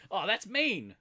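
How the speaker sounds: "Oh, that's mean" tossed off as high, loud praise; approving Kiwi mate. Shown as a demonstration. Kiwi praising a mate's play, loud and high. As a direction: cheer a mate with it. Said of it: "mean as actually means good".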